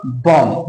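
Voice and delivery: बॉम्ब falling to a closed m, no b; silent B. Shown as a demonstration. Teacher repeating the answer for students, silent b. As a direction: end बॉम्ब on a hummed m without any b. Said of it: "बी silent हो जाएगा".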